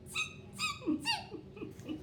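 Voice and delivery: very high squeaky monkey voice; monkey chatter. Acted. Monkeys mock the peddler back in high squeaks. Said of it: "both their hands back at him and said".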